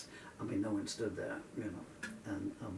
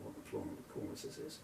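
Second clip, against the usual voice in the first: whispered under breath; whispered aside; 13 semitones lower, 9 dB quieter than usual. Real, not acted. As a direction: whisper it to yourself. Aside while unsure of word.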